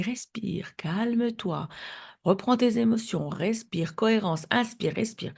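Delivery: hushed reassurance; retold soothing. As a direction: talk someone down from panic, gently, one instruction at a time. Calming a hysterical mother, retold.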